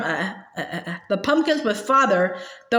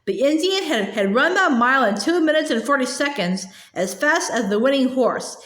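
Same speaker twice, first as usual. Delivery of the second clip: usual speaking voice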